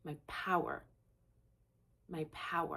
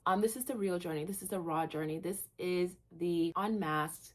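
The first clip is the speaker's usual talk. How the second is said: normal talking voice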